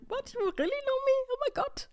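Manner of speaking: very high squeal of disbelief, dropping to a whisper on the middle "Oh my God"; overwhelmed that strangers know her; astonished disbelief. Retold or imitated. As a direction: she gasps at being recognized abroad, in squeaky disbelief. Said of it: "And I was like".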